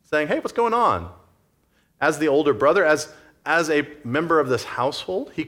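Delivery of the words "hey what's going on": bright, high, open greeting; just walk in and call out; open casual call. Shown as a demonstration. Older brother striding in, calling out openly. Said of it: "Instead of walking in, saying".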